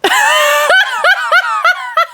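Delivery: high burst of laughter; big shrieking laugh. Real, not acted.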